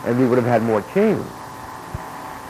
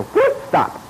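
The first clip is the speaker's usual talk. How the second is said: high whoop then stop. Retold or imitated. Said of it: "I go".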